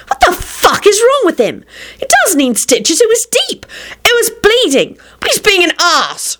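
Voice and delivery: very high, furious outburst; screaming. A narrator's reading. Rosie furious at William's exit, screaming high. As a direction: shriek in outrage, pitch way up. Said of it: "she screamed".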